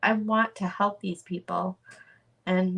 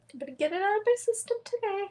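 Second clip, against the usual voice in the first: fast, high, tear-choked voice; crying; 14 semitones higher, much faster than usual. Real, not acted. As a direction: push the words out through real sobs. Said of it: "I don't mean to cry".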